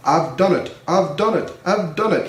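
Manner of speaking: rising proud cheer; proudly. A narrator's reading. Gordon reaching the top of the hill, proud cheer. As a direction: crow it out, pleased. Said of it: "he said proudly".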